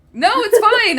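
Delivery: high, loud flustered protest; frantic Eric. Acted. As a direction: blurt it out, panicking on the phone. Eric shouts down the phone.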